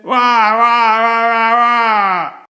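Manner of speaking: put-on loud high baby wail. A narrator's reading. Baby wailing at the teacher. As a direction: wail like a crying infant. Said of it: "The baby looked at the teacher and said".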